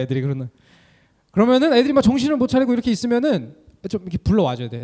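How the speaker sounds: ordinary delivery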